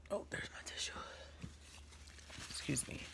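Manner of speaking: breathy aside under the breath; real whisper. Real, not acted. To herself while grabbing a tissue, whispered. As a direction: whisper it off to the side.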